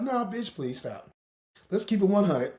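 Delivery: ordinary talk, nothing marked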